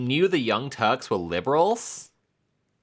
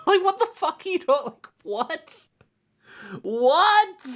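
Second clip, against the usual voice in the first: much higher, strained cry of disbelief; incredulous outburst; 3 dB louder than usual. Real, not acted. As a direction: shriek it like you truly can't believe it. Exasperated disbelief at Anna.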